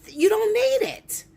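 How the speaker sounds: high, loud insistence; need stretched out high and loud; emphatic shout. Real, not acted. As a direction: bark it at her. Heated rant.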